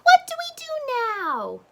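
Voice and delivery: duck voice. Acted. Duck's voice.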